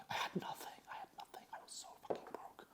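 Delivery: hushed, breathy confession of being broke; desperate whisper. Retold or imitated. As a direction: whisper it like a shameful secret.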